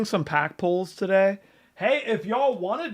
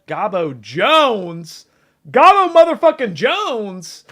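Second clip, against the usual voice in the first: louder, higher hype call; hyped streamer shout-out; excited shout-out; 4 semitones higher, 9 dB louder, slower than usual. Real, not acted. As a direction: pump up the name like a ring announcer.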